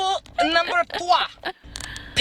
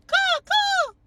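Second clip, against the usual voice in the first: high dove coo. Retold or imitated. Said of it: "They don't go".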